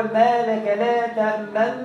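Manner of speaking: slow Quranic recitation with full damma on تأمن; full vowel recited. Shown as a demonstration. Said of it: "تأمن في الوجه الأول".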